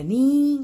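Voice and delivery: नी with a long, held "ee"; drawn-out नी, demonstrating a long vowel; long open syllable. Shown as a demonstration. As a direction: stretch नी out and leave it open. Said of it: "इसलिए ये long".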